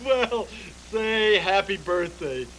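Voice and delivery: normal talking voice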